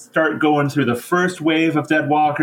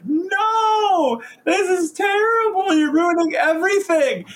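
shrill falsetto tantrum, much higher; Neferata's hissy fit; slower than usual. Retold or imitated. Narrator mocks the vampire queen's meltdown in a falsetto hissy fit. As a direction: throw a hammed-up high-pitched hissy fit as the villain. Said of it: "Neferata throws a frickin' hissy fit".

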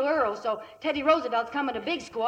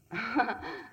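a short, softer chuckle under the breath; soft amused laugh; 5 dB quieter than usual. Acted. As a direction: laugh off the silly idea. Laughing at silly idea.

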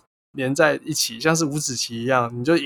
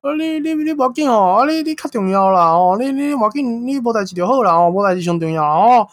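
put-on old man in Taiwanese; 8 semitones higher than usual. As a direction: croon reassurances like a fussy old uncle. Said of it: "那个阿伯就说".

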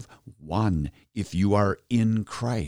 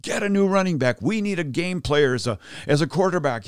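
mock yelling at TV; 6 semitones higher than usual. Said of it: "I'm yelling at the television".

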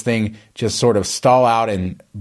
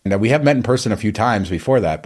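ordinary talk, nothing marked

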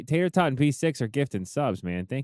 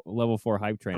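ordinary delivery

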